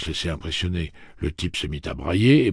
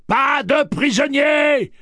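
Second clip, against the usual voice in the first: slow, much higher, loud yell; 11 dB louder, slower than usual. Acted. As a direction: bellow it, drawn out. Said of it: "hurle Roland".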